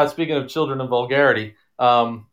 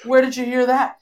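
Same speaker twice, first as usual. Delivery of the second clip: high-pitched imitation of a startled mother; mock accusing wife; 10 semitones higher than usual. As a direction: put on a high voice, demanding an answer. Wife confronts daughter in retold story.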